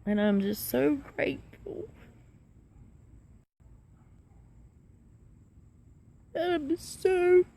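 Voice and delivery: high, tearful voice breaking with joy; crying through the words, overwhelmed with relief; happy crying. Real, not acted. To her viewers, sobbing with happy tears. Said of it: "I'm crying because I'm happy".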